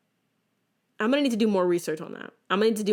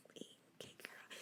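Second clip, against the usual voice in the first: whispered under the breath; grudging whisper; 30 dB quieter, slower than usual. Retold or imitated. Daughter answering mom, whispered grudging okay. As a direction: whisper it so mom can't hear.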